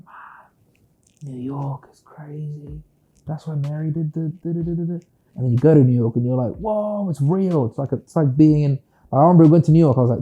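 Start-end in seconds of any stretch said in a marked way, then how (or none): 0.1-5.0 whispered in awe, slowly; hushed awe. Retold or imitated. A UK kid picturing New York, whispered in awe. 6.6-7.7 amazed. Retold or imitated. Arriving in New York for real.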